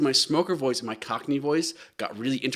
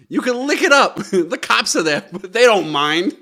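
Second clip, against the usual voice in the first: put-on excited Boston kid, a touch louder; lightly voiced excited Boston child; Boston accent; 4 semitones higher, 6 dB louder than usual. Shown as a demonstration. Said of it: "excited child who has a strong Boston accent".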